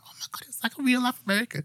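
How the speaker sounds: high, giddy mock squeal of delight; starstruck squeal. Telling a friend about her first call with an American, high starstruck squeal. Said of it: "and I was like".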